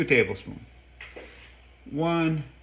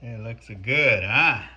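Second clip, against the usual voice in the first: ordinary talk, nothing marked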